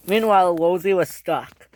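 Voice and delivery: ordinary delivery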